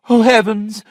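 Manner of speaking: high, loud startled cry; cried out. A narrator's reading. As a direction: wake with a sudden cry. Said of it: "Sophia opened her eyes and cried".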